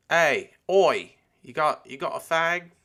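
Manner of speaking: loud, high mock British oi; loud hammed-up British bar girl; rowdy British shout. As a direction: bark it like a rowdy Brit at the bar.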